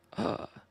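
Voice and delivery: sudden excited gasp; sharp gasp of surprise. Real, not acted. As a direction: gasp at the shiny. Spots a shiny Gible.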